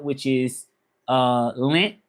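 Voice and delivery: usual speaking voice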